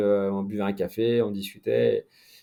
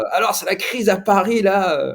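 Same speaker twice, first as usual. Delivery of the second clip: voix plus aiguë de journaliste anglais, légèrement jouée; imitation du journaliste; 7 semitones higher than usual. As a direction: imiter le collègue qui lance le sujet, plus haut. Retells English journalist's request. (journaliste anglais imité, voix plus aiguë)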